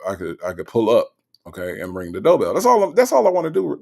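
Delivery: usual speaking voice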